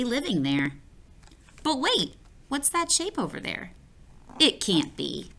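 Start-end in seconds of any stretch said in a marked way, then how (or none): 1.6-5.3 surprised. A narrator's reading. Narrator spots something surprising.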